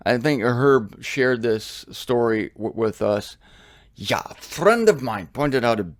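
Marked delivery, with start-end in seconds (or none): 4.0-5.9 German accent, lightly put on. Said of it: "sorry, my German accent".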